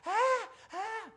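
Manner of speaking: high, repeated imitated baby wails; mock baby crying. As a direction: imitate a baby bawling in bursts. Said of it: "애기가 막 울어요". (아기 울음소리를 높게 흉내)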